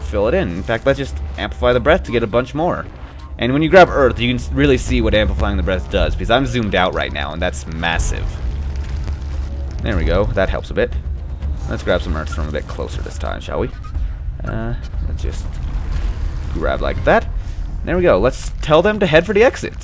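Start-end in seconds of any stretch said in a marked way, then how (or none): none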